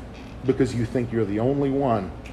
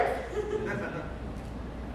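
high breathy laugh; 10 dB quieter than usual. Real, not acted.

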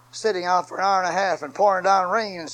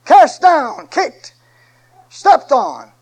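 louder and higher to a slow peak; building preacher cry; 9 semitones higher, 12 dB louder, much slower than usual. Acted. Preacher building the list to a shouted climax. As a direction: pile each word on to the climax.

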